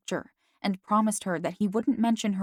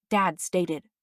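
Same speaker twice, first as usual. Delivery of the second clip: ordinary delivery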